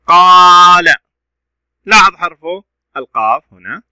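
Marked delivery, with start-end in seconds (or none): 0.0-1.0 قال with the alif stretched out long and loud; loud, drawn-out aa in قال; long vowel. Shown as a demonstration. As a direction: hold the aa in قال. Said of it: "صوت طويل".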